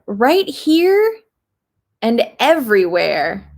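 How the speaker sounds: lightly voiced scheming crocodile. A narrator's reading. Crocodile asking the dentist for repairs, sly. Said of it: "He said".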